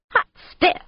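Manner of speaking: high, punchy exclamation; excited outburst. A narrator's reading. Pilot hearing of a new company, excited. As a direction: burst out with it, pitch jumping up. Delighted outburst at partnership news.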